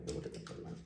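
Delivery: ordinary talk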